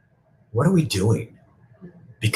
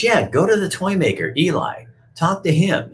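higher, bright and helpful; higher girl puppet voice; 11 semitones higher than usual. Retold or imitated. Lucinda sends Punchinella to Eli, higher and helpful. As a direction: point him up the hill, lightly and higher.

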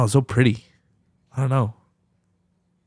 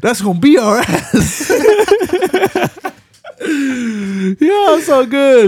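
high laughing wheeze; 14 semitones higher, 4 dB louder, much slower than usual. Real, not acted. Cracking up while retelling the movie to a friend, laughing. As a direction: laugh through the words, much higher.

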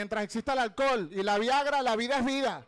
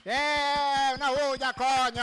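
calling out; 3 semitones higher, 4 dB louder, much slower than usual. Real, not acted. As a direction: hype the crowd up. Calls for applause.

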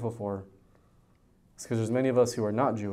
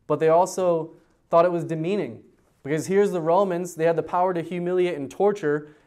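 louder, higher preaching push; preaching with force; 5 semitones higher, 5 dB louder than usual. Acted. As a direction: press the point of the Romans' mockery. Preacher rising again.

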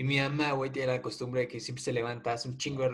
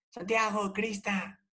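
imitating mom calling; 9 semitones higher, slower than usual. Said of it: "ese día igual gritó".

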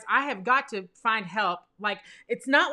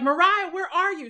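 higher-pitched retold call for someone; 8 semitones higher than usual. Calling to her sister in the woods, searching call. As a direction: call out for your missing sister.

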